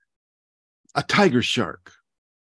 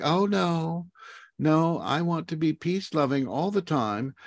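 higher-pitched, prissy mock voice for the animal; a lightly higher, mocking animal voice; mock peace-loving tarantula; 6 semitones higher than usual. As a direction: play a sweet, harmless creature for a laugh.

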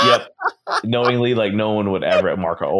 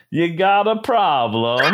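slow, high attempt at Iago; 9 semitones higher, slower than usual. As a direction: have a go at the parrot voice. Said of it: "Go, go for it".

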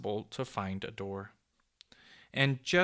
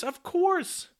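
even higher, loud, in a different voice; excited squeal; 8 dB louder than usual. A narrator's reading. Popoff leaping to his feet, shrieking excitedly. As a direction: top the first one, shrieking with glee. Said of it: "in a sort of an excitement".

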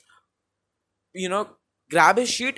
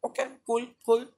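high, mocking shrug of a voice; higher, teasing and dismissive; mock Liverpool voice; 9 semitones higher than usual. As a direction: play it like a smug team that can't be bothered. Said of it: "And Liverpool are like".

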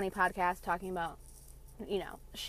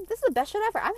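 much higher, louder joy; gleeful squeal; 12 semitones higher, 6 dB louder, faster than usual. Real, not acted. Podcaster celebrating her free nap, gleeful squeal. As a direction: squeal with delight. Delight at no alarm.

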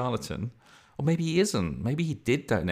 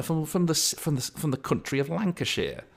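higher-pitched put-on Lancashire accent; playful Lancashire accent, pitched up; 8 semitones higher than usual. Shown as a demonstration. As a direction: keep up the mock Lancashire voice. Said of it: "into my bloody Lancashire accent".

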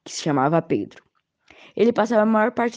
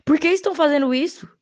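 shout; 10 semitones higher than usual. A narrator's reading. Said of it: "chegou à frente deles e gritou".

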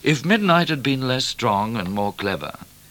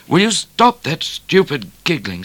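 high, loud, cross rebuke; 8 semitones higher, 6 dB louder than usual. A narrator's reading. Midnight to the laughing bushranger, cross. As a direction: snap it crossly. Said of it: "said Midnight crossly".

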